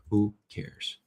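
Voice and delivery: normal talking voice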